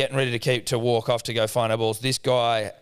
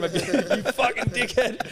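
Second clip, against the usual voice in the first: high, slow, laugh-choked swearing; laughing retold insult; 10 semitones higher, slower than usual. Dad walking off from the kid, retold while laughing. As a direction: barely get the insult out through laughter.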